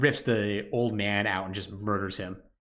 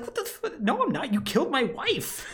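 mock outraged Ethan; 13 semitones higher than usual. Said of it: "And Ethan's like".

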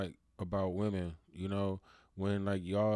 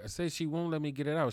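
ordinary delivery

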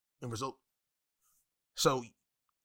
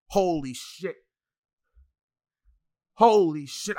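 exasperated; 3 semitones higher, 10 dB louder, much slower than usual. Real, not acted. Exasperated at a bad women's division.

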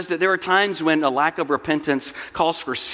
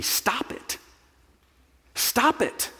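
curt, higher-pitched order; mock command; 7 semitones higher, 4 dB quieter, slower than usual. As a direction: issue it as a terse command. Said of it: "we heap up commands. We say".

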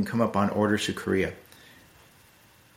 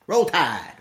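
Southern accent cheer; 7 semitones higher than usual. Shown as a demonstration. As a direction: Roll Tide called high with a light Southern twang. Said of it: "just go ahead".

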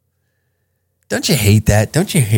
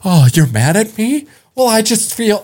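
much higher mocking whine; mock offended woman; 9 semitones higher than usual. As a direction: parody a hurt reply, pitched way up. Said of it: "when you bring feelings to the table".

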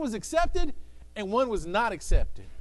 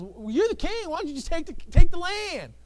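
higher, lightly voiced scheming queen; a hint of a scheming wife, higher; Jezebel; 8 semitones higher, 6 dB louder than usual.

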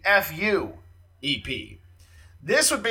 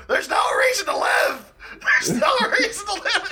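much higher, louder hammed-up wail; mock despair; 3 dB louder than usual. Retelling a Conan bit, hammed-up high despairing shout. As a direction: wail it high as fake despair, breaking into a laugh. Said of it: "They'd run up the stairs and go".

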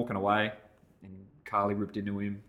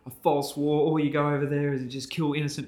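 higher, hammed-up hostile accusation; anti-police drunk ranting at the couple, higher and aggressive; mock accusing rant; 6 semitones higher, 4 dB louder than usual. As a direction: throw the insult like a drunk bully. Drunk guy accusing him.

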